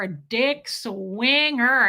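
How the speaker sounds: ordinary delivery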